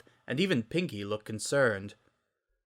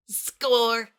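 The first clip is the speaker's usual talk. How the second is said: high, loud cheer; 12 semitones higher, 4 dB louder than usual. A narrator's reading. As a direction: punch the air with it. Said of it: "Rainbow cheered".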